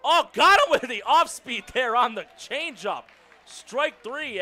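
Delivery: real excitement on the call; excited strikeout call. Real, not acted. Calls a strikeout live.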